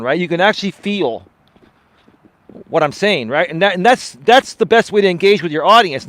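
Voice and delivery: ordinary delivery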